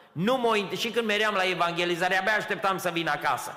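loud, high, fast preaching climax; full-force preaching. Acted. Preacher testifying to the congregation, fiery full-force preaching.

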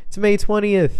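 "May twentieth" called out high and loud; loud alarm. Shown as a demonstration. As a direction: shout it like a wake-up call.

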